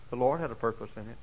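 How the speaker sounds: ordinary delivery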